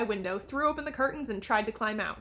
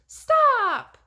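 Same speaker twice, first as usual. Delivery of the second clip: much higher, loud shout; desperate cry; 14 semitones higher, 11 dB louder than usual. A narrator's reading. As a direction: scream it to stop them. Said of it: "Daphne cried".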